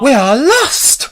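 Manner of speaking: high, slow wail of doom; despairing cry. A narrator's reading. Miss Spider panics wringing her feet, despairing cry. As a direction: cry out that all is hopeless. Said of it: "Miss Spider cried".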